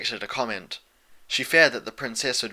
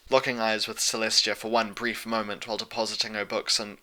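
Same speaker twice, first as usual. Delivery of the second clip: ordinary delivery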